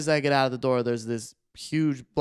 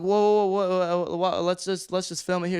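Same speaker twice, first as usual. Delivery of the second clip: higher-pitched retold alarm; sorta freaking; 5 semitones higher than usual. Storyteller stalls stranger who jumped in his car, retold freaking. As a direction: stammer out a mild panic, pleading to stall. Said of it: "I was sorta freaking".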